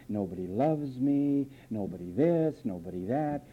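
low, soft whining list; lightly voiced mopey complaint; mock self-pity. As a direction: moan it like a victim. Mimics self-pitying complainer.

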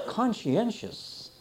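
slow, relished conscientious; savoring the word. Shown as a demonstration. Preacher savoring a word he loved as a child, slowly. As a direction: draw out conscientious, enjoying each syllable. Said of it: "such a neat word".

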